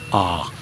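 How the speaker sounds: "ah" said long and low; ah vowel. Shown as a demonstration. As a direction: let the "ah" sink. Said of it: "so it's said".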